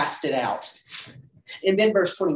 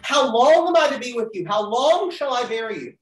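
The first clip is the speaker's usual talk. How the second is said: a touch louder and higher, weary exasperation; exasperated God; 6 semitones higher, 5 dB louder than usual. Acted. Teacher voicing God's lament to the class, mildly exasperated. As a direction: lightly voice the tired, fed-up prophet. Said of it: "who talks like this".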